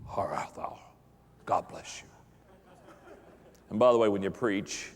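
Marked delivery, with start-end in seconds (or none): 0.1-2.1 low, hushed, whispered pious greeting; hammed-up holy-man greeting; mock preacher's voice. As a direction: drop into a solemn pulpit whisper. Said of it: "you'll get a preacher's voice".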